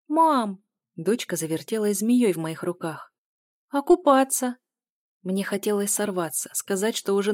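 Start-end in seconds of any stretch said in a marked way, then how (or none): 0.1-0.6 put-on high, loud child's call; insistent little-girl voice; pleading child. A narrator's reading. Child whining to mother. 3.7-4.6 put-on high, loud little-girl question; insistent childlike plea; pleading child. A narrator's reading. Child begs to swim.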